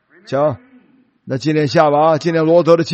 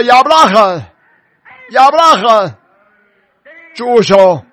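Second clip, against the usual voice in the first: raised, loud voice of God calling out; 神的呼唤; 4 semitones higher, 4 dB louder than usual. Retold or imitated. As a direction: call his name urgently to halt him. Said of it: "神的声音发出来". (提高嗓音，像神一样大声喝止)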